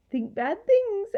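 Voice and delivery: high, slow parroting creature; mocking echo. A narrator's reading. Gaboon repeating the girl's words, mockingly. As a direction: echo her in a teasing singsong. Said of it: "echoed the gaboon".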